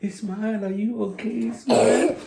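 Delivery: high caring parent voice, rising into the question; worried parent. Retold or imitated. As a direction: lightly play the anxious parent checking on a baby. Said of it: "They would run over and be like".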